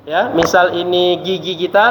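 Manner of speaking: usual speaking voice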